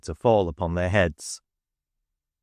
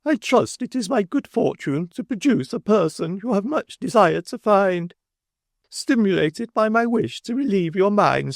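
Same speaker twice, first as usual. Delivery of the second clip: high, prim self-satisfied speech; officious Mrs Sparsit; 11 semitones higher than usual. A narrator's reading. Mrs Sparsit reporting to Bounderby, high and self-satisfied. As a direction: present her with much higher prim pride.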